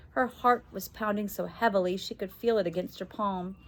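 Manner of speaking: ordinary delivery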